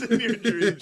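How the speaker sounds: high-pitched words through laughter; laughing tease. Real, not acted. Friend joking about bullying him in dreams, laughing tease.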